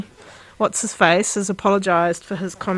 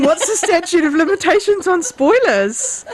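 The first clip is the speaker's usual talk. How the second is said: higher, slower, laughing through it; laughing repeat; 7 semitones higher than usual. Real, not acted. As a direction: laugh while repeating the question. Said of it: "I'm just saying".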